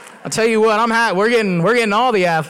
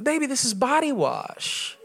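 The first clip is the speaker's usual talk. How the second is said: gently incredulous wife; 3 semitones higher, 6 dB quieter, slower than usual. Retold or imitated. Retold wife breaking news to husband.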